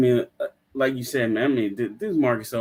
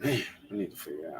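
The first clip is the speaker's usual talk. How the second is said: reluctant grumble; 9 dB quieter than usual. Retold or imitated. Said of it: "You sound like you fighting me".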